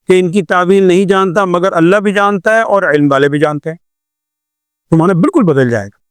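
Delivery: normal talking voice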